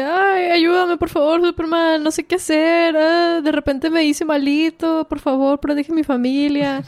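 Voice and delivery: mock pleading kid. Billy faking helplessness to trick Superman, mock pleading. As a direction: play a fake helpless boy begging. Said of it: "Billy le dice".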